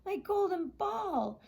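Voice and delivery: high, slow, thrilled cry; excited princess. Acted. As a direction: squeal over the ball. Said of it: "said the princess".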